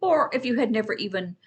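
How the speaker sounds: usual speaking voice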